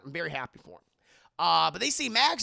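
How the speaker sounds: normal talking voice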